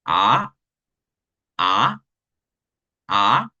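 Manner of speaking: a that climbs upward like a question; second tone. Shown as a demonstration. Teacher demoing the rising second tone. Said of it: "va a comenzar débil y un poco más fuerte".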